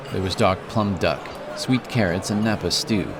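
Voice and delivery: ordinary delivery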